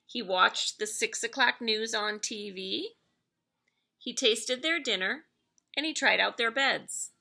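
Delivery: usual speaking voice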